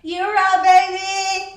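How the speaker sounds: loud, high, thrilled; excited shout. Real, not acted. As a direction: belt it out with joy.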